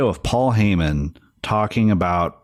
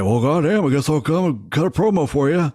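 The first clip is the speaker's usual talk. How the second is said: higher-pitched, drunk-sounding wrestler impression; Steve Austin; 8 semitones higher than usual. Fan imitating a storyteller's wrestler impression, bad drunk voice. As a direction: badly imitate a wrestler like a tipsy friend would. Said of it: "sounded exactly like your drunk friend".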